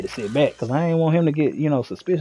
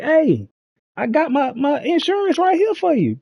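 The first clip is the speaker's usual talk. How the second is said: very high, eager call to the cop; retold calling out to the cop; calling after him; 12 semitones higher than usual. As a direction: call out so he turns around.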